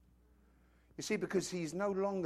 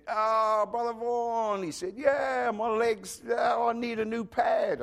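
imitating Herbie; 5 semitones higher, slower than usual.

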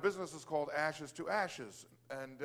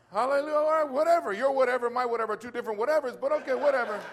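loud, high, laughing-edge shrug-off; exuberant hallelujah; 6 semitones higher, 8 dB louder, faster than usual. Retold or imitated. The man answering the customer's whatever with loud hallelujah. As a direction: celebrate loudly while mocking his whatever. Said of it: "I'm like".

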